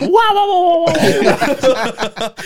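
high loud wah wah imitation; mock medic gibberish. Friend mocking the Italian medic, high babbling wah wah. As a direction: parrot the medic as babble, laughing. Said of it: "He went".

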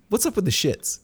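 mock interviewer voice. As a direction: ask it like a silly TV interviewer. Mock interview question to Craig.

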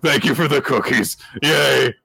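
high, loud, gushing thanks; excited thanks. Retold or imitated. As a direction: cheer it like a delighted kid. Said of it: "They're like".

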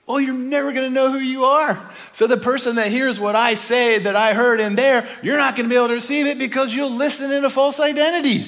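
Moses rebuking. Acted. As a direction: scold them with rising heat. Moses answers the fearful people.

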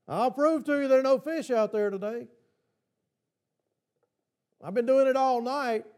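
a hint of smug fisherman; sarcastic Peter. Peter daring Jesus there are no fish, mildly sarcastic. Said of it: "I can hear the sarcasm in his voice".

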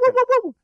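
very high falsetto woos; high mock hooting. Joking about a movie character for a co-host, high falsetto hooting. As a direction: hoot it way up high like a crazy man. Mimicking a sound.

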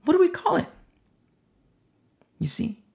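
ordinary delivery

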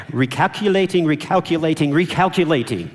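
a hint of the GPS announcer repeating. Shown as a demonstration. Pastor leading the congregation, mock sat-nav voice. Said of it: "You can even use a little English accent".

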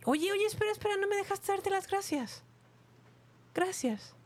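high, soft put-on voice; squeaky character calling out; high comic voice. Sketch character calls after.

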